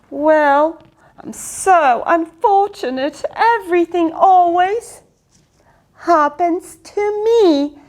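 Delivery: high, slow self-pity moan; self-pitying whine. Acted. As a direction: whine like poor little me. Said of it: "he said it again".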